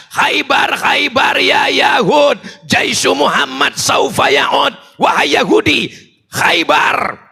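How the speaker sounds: high, ringing rally cry; seruan yel berapi-api. Acted. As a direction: chant it out to rouse the crowd. Seruan yel Khaybar kepada jemaah. (seruan lantang bernada tinggi membakar semangat)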